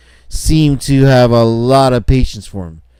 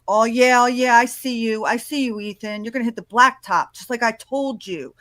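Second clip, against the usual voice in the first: lightly voiced cocky guy, pitched up; aggressive guy; 10 semitones higher than usual. Mimicking an aggressive guy picking a fight with Ethan, lightly taunting. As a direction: imitate a guy with attitude, lightly. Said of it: "that's how he's talking".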